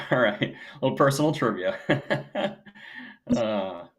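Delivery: usual speaking voice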